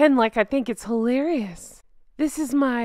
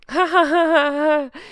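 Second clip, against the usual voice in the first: fake laugh; 4 semitones higher, 3 dB louder than usual. Mock fake laugh for cameras.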